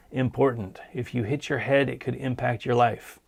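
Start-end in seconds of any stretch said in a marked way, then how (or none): none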